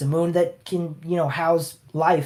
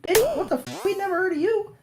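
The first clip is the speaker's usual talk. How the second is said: much higher, squeaky scandalized voice; shrill mock outrage; 3 dB quieter, faster than usual. The royals snap at the uninvited villain, high mock squeal. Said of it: "And they're like".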